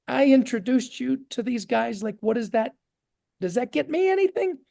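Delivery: retold bewilderment climbing high at the end; confused. Young seller asking the new chairman for his share, imitated confusion. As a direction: imitate a puzzled, hopeful question, pitch rising. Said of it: "I'm kinda confused".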